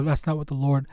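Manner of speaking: normal talking voice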